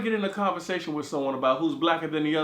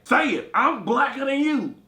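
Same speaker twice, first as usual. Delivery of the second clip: slow taunting demand; 6 semitones higher, 4 dB louder, slower than usual. Retold or imitated. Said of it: "And Kimberly was like".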